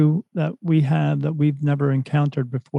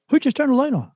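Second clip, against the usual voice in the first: startled question; 11 semitones higher, faster than usual. Retold or imitated. Group reacts to sudden flash.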